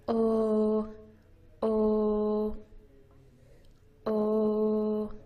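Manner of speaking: "Oh" held long and even with circled lips; rounded oh vowel. Shown as a demonstration. Teacher modeling the vowel, rounded voiced oh. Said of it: "Make a circle with your lips".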